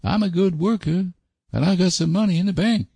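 high-pitched country fellow, earnest; solemn rustic suitor. Acted. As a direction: state your plans in a high rustic voice. Voices Sam pressing his suit.

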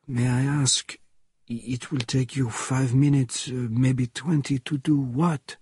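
put-on hesitant elderly French character voice; puzzled old French character, rising at the end; old Frenchman, puzzled. A narrator's reading. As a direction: query it haltingly as an old Frenchman. Old Frenchman questions the nurse.

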